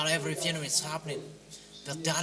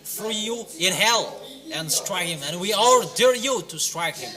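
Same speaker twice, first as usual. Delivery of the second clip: loud, pounding sermon delivery with higher pitch; fiery preaching; 4 semitones higher, 6 dB louder than usual. Real, not acted. Preacher condemns the unbelievers in a loud, driving sermon. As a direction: preach it hard to the crowd, almost shouting. Preacher exhorting crowd loudly.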